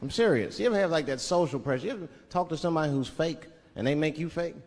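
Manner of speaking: ordinary talk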